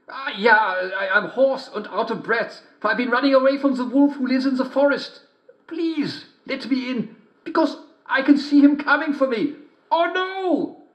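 high, poorly faked girl voice; wolf badly faking a little girl; wolf at the door faking the girl's voice; bad imitation of a girl. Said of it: "not very good imitation".

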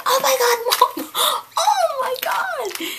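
high, loud, slow squeal with laughter; excited squeal. Retold or imitated. Telling mom in the store, slow ecstatic squeal. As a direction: gush at mom in delight.

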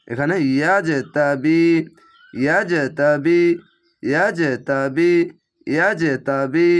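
ইয়াজতাবি said slowly again and again, final বি lengthened; slow repeated ইয়াজতাবি; repeated recitation drill. Shown as a demonstration. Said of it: "অনেক দ্রুত পড়তে পারবেন".

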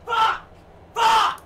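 high, loud bursts of cursing; raging swearing. Real, not acted. Gamer losing it at the game, raging shout.

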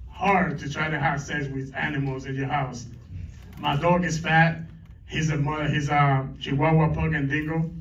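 usual speaking voice

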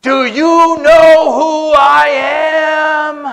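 mock furious bellow, high and slow; hammed-up indignant shout, every word stretched; irate. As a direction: bellow it like an outraged big shot. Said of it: "became irate and got red in the face".

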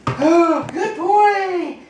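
excited praise. Real, not acted. Praising dog on balance ball.

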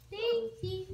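calling out. Real, not acted. Calling the dog.

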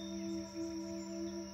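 long, airy sigh out through the mouth; slow cleansing out-breath; demonstrated exhale. Shown as a demonstration. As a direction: let the breath go in a soft open-mouthed sigh. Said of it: "And exhale through the mouth".

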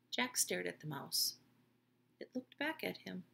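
ordinary delivery

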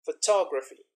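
"Photography" with weight on "tog"; second-syllable stress. Shown as a demonstration. Teacher demonstrates shifting stress, "Photography" stressed on the second syllable. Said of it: "second, and the third syllable".

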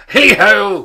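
high, jovial holler; Fezziwig. A narrator's reading. Fezziwig skipping down from the desk, hollering jovially. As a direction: sing out a huge hearty call. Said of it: "cried old Fezziwig".

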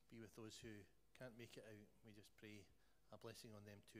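low, soft prayer voice. Real, not acted. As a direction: pray aloud in a subdued, measured voice. Leads a prayer.

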